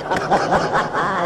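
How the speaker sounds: high, soft giggle; short high laugh, falling away. Real, not acted. Speaker amused by the five-hundred coins, high soft laugh.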